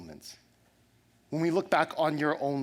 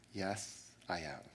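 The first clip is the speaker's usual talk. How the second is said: sheepish quiet yes; 7 semitones lower, 12 dB quieter, slower than usual. Retold or imitated. Caught answering a challenge.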